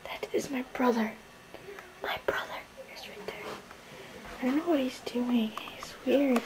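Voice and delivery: hushed whisper so brother won't hear. Real, not acted. Whispering to camera about her brother nearby, hushed. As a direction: whisper it behind his back.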